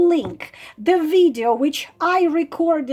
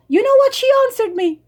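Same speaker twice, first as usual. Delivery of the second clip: higher, faster, louder, disbelieving; incredulous repeat; 8 semitones higher, 3 dB louder, much faster than usual. Real, not acted. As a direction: hit it again in disbelief.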